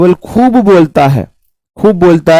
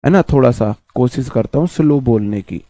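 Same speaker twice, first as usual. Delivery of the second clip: speaking softer through a sore throat; 5 semitones lower, 6 dB quieter than usual. Real, not acted. Teacher apologising to students for his weak throat, soft and strained. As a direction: hold the voice back, throat hurts. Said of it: "आप देख रहे होगे गला ज्यादा खराब है".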